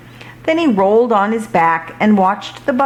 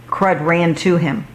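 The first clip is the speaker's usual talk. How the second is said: usual speaking voice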